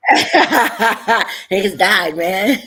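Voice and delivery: laughing while talking. Real, not acted. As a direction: laugh through the words. Laughing reaction to no resolution.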